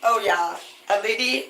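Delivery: usual speaking voice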